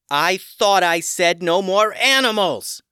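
loud, high, slow angry yell. A narrator's reading. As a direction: yell it in exasperation. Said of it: "Tatiana yelled".

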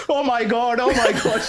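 high, shrill-free mock panic, "Oh my God" twice; mum's shocked gasp. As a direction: imitate a horrified mother. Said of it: "she goes".

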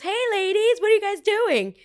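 high, loud sing-out hello; cheery uncle greeting. Retold or imitated. Uncle surprising his nieces at the airport, loud cheery call. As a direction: call out an exaggerated hearty greeting.

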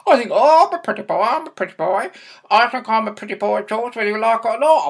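parrot talk. Pretend. Dad boasting to George as a rival pretty boy, parrot voice. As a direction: squawk it proudly like a parrot. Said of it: "Can you talk like a parrot".

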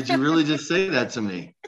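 can't believe he said that; shocked disbelief. Retold or imitated. Retelling her stunned reply to a rude guest. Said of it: "I'm like".

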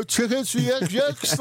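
high, slow street tout call; London ticket tout. Retold or imitated. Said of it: "Is that your London accent?".

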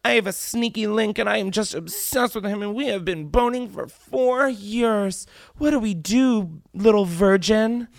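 high-pitched, bragging lovesick caller; mocking caller impression. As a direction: rub it in with a high mock-girlish whine. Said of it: "That is like rubbing it".

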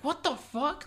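high-pitched disbelief; acted startled squeak; baffled shock. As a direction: pitch it way up in mock bewilderment. Acted shock at not being known.

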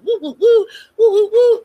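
high, loud mock siren whoops; playful warning siren woo. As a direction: sound the alarm like a siren. Mock alarm siren sounds.

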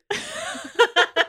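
high burst of laughter; a real, high, tortured laugh; pained laugh. Real, not acted. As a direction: laugh out at how much it hurt. Tortured by the ending.

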